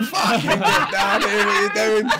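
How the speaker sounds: hiss-shout him back down into his seat, laughing; panicked shout. Retold or imitated. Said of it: "I've gone like that".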